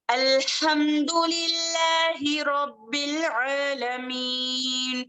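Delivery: slow, melodic tajwid recitation; Quran recitation. Acted. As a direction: recite the verses with care. Recites Fatiha.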